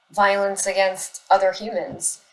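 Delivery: ordinary delivery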